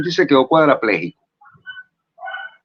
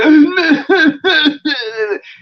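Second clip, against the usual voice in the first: sudden cry burst; 6 dB louder than usual. Shown as a demonstration. Demonstrating crying while explaining.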